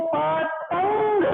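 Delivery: slow, spelled-out पतंग; पतंग said slowly, nasal after त; slow teaching demo. Shown as a demonstration. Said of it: "इसका उच्चारण इसके बाद किया जाए". (धीमा, समझाने वाला उच्चारण)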